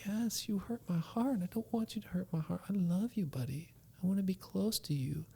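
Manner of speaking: soft whispering father; father whispering comfort to his son; tender whisper. Retold or imitated. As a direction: whisper love to calm him. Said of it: "It's like".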